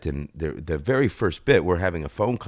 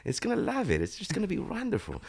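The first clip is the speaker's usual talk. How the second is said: much higher, laughing and beaming character voice; higher, smiling inflection; 13 semitones higher than usual. Shown as a demonstration. Actor demonstrating Cristobal's sunny inflection to the host, higher and smiling. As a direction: let the smile carry every word, pitched way up. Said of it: "a little higher inflection and just a little bit of a smile".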